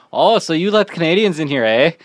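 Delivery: put-on Canadian accent. Bartender retelling a visiting couple's remark, imitated Canadian accent. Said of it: "their natural Canadian accent kicking in".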